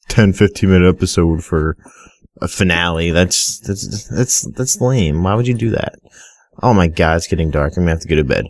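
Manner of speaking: normal talking voice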